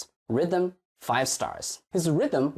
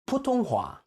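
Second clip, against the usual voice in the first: 普通话 with the learner's wrong stress; imitating wrong stress; 10 semitones higher than usual. Shown as a demonstration. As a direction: show the mistake in 普通话. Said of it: "He did it with 普通话".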